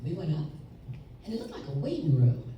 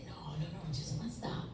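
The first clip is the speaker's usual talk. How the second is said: hushed insistence; 3 semitones higher, 13 dB quieter than usual. Retold or imitated. Grandfather hushing, insisting quietly.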